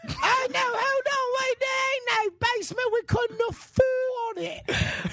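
silly squeaky mock British; hammed-up homeowner in a high squeak. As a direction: stretch it out in a put-on squeak. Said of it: "high squeaky voice convention".

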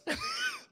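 high burst of giggling; squeaky laugh. Real, not acted. Host laughing at the orcs' chant. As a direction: cracks up mid-reading.

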